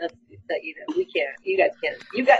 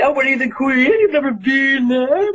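mocking Texans; 4 dB louder than usual. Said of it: "they were making fun of me".

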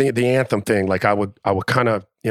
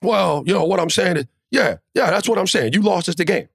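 a hint of a flustered coach, pitched higher, stammering; mock fumbling coach; 9 semitones higher than usual. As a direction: imitate the coach scrambling, voice up high. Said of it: "And he fumbled".

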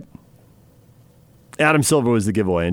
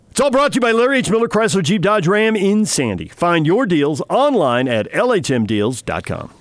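upbeat radio sponsor read, higher pitch; 5 semitones higher than usual. Acted. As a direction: sell the dealership like a DJ.

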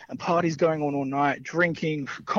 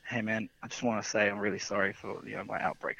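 sheepish apology; 5 semitones lower, 8 dB quieter than usual. Retold or imitated. Drunk mate apologising after outburst.

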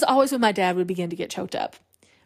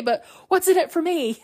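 high, emphatic mock whine; hammed-up indignant plea, pitch soaring; upset demand; 9 semitones higher than usual. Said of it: "Ray gets really upset".

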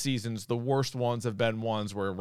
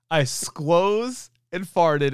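slow, drawn-out goofy quote; Tim Robinson impression; 5 semitones higher, 4 dB louder, much slower than usual. Retelling a sketch line to a cohost, slow goofy voice. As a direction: drag out the silly word squoze. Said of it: "he says that he squoze".

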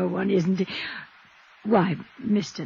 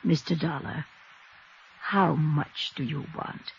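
hushed bribe; 3 semitones lower, 7 dB quieter than usual. Acted. Bribing Dollar, voice drops low.

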